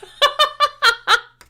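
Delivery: high loud cackle. Real, not acted. Streamer cackling at her own twang joke.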